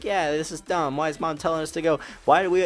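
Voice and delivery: high-pitched imitation of a griping kid; annoyed little brother. Little brother siding with the driver, imitated high annoyed voice. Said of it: "He's kinda mad about it".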